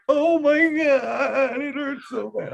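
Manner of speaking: high, drawn-out mock wail; hammed-up whiny kid in pain; wailing child. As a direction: imitate a little kid crying over a hurt ankle. Imitating hurt son wailing in pain.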